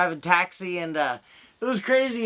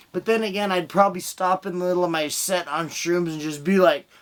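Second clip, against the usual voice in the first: usual speaking voice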